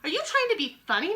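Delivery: high, prim and indignant lady. Acted. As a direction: purse the lips and sniff it out. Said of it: "very prim".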